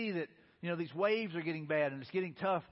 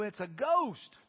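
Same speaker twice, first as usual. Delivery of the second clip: raised, startled cry; freaking out; 4 semitones higher, 4 dB louder, much slower than usual. Acted. Exhausted disciples spotting a figure on the water, freaked-out cry. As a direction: blurt it in acted alarm. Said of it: "they're freaking out and they go".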